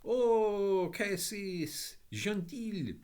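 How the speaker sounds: put-on high, delighted French boy's cry; excited shout. A narrator's reading. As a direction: burst out in childish French joy. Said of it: "suddenly shouted".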